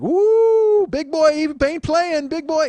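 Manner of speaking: high loud slow hooting onlooker; hooting crowd. Retold or imitated. Street crowd cheering the big man, loud and high. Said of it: "people in the street are just like".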